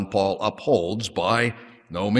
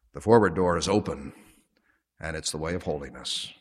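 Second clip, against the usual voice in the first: prayer; 2 semitones lower than usual. Acted. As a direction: pray gently to the Father. Pastoral prayer closing the sermon.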